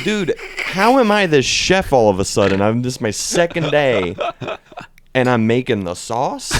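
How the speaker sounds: loud, incredulous rant; exasperated protest. Retold or imitated. Second-day worker to chef about the sauce, exasperated protest.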